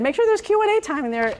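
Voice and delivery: high, brisk mock of an organizer's reminder; quoting Jane. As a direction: lightly voice Jane's reminder. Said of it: "Jane said".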